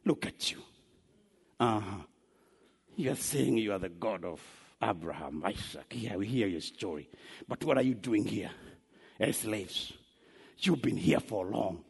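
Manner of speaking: low, quiet taunt; mocking onlookers. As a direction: sneer softly, deep down in the voice. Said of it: "people are looking at them and saying".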